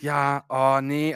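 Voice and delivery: very slow drawn "oh ne"; dragged-out dismay. Retold or imitated. Doctor on the phone, slow mock dismay.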